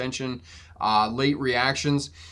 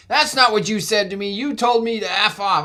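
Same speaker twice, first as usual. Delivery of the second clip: higher, mildly mocked outraged accusation; angry driver; 8 semitones higher, 5 dB louder than usual. Pickup driver accusing the trucker, mock outrage. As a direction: imitate a fuming driver. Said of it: "He's like".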